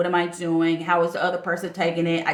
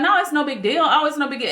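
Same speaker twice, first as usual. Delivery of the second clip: raised, easygoing reassurance from the cousin; cousin reassuring; 7 semitones higher than usual. Retold or imitated. Cousin telling her apology is no big deal, lightly reassuring. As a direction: brush it off lightly, pitched up. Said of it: "my cousin was like".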